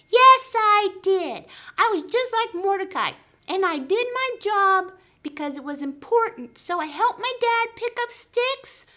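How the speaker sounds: very high, loud puppet voice; squeaky puppet. Pretend. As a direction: play the eager little puppet pal. Puppet Whiskers speaks.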